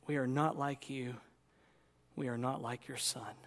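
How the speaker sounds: low, halting prayer voice. Real, not acted. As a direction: confess humbly to God in prayer. Leads a prayer.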